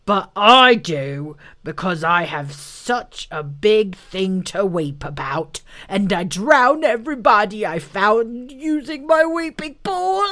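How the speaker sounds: angry voice. A narrator's reading. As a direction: rage like a wounded big animal. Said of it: "said the angry voice".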